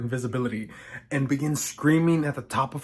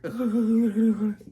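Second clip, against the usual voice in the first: put-on high-pitched girlish giggle, loud; high mocking giggle; 9 semitones higher, 5 dB louder than usual. Little feminists sneaking up, high mocking giggle. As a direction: giggle high and bright like girls closing in.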